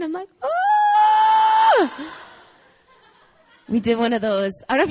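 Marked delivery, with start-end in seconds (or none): 0.4-2.1 very high, loud reunion shriek; excited squeal. Retold or imitated. Long-lost cousins recognise each other, excited mock shriek. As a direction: let out a long girlish squeal.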